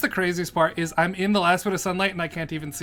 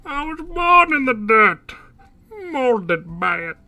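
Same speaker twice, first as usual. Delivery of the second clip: louder, muffled villain through a cup; Bane voice into a cup; 8 semitones higher, 8 dB louder, slower than usual. Retold or imitated. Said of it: "A cup just makes you sound like Bane".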